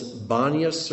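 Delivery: Banias as BAH-nee-us, stress first; first pronunciation variant. Shown as a demonstration. Teacher offering one way to say a place name, Banias stressed on BAH. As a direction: say Banias with an open "bah" up front. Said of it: "not sure how it's pronounced".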